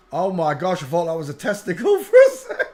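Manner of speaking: loud, high laughing disbelief; laughing shock. Real, not acted. Host laughing in shock at a photo, loud laughing.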